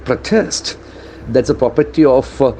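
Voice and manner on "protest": accent the second syllable of Protest, verb form; stress on second syllable. Shown as a demonstration. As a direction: Protest with the beat on TEST. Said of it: "accent for the second syllable".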